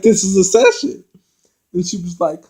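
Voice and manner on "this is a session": mock indignant retort. Masika snapping back at Brandy.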